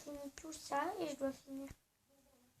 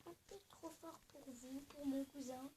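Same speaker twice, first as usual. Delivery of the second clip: much softer, apologetic aside; lowered voice; 14 dB quieter than usual. Real, not acted. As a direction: turn the volume right down. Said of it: "est-ce que tu peux baisser ton son".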